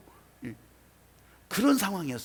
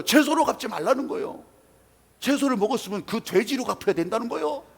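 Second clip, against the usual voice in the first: raised, heated voice of a wronged man; higher and louder, protesting the unfairness; indignant complaint; 6 semitones higher, 4 dB louder than usual. Retold or imitated. Angry man explains the neighbors' demand. (억울해서 목청 높여 따지는 목소리)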